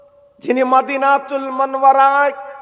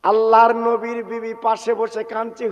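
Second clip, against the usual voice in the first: ordinary delivery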